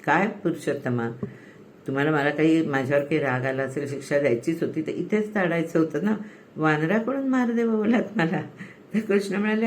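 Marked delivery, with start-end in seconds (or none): none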